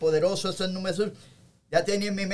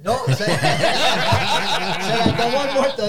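laughing; 4 dB louder, slower than usual. Real, not acted. Laughing at spilled beer story.

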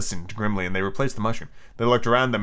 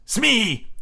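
high, drawn call to the henchman; unsettled captain; husky; 10 semitones higher than usual. Acted. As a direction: call out with a quiver. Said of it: "he said huskily".

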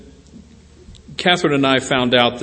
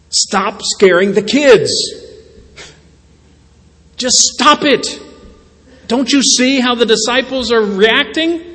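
Jesus telling off the storm, higher and louder, slowed at first; Jesus scolding; 10 semitones higher, 4 dB louder than usual. Retold or imitated. Preacher voicing Jesus rebuking the storm, raised parental scold. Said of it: "I kind of view this response".

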